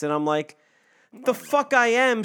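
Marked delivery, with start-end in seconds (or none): 1.1-2.2 high, drawn-out mock indignation; indignant retort. Speaker snapping back at being called sexist, acted indignation. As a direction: push back in a high, stretched-out voice.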